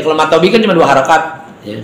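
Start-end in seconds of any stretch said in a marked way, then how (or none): none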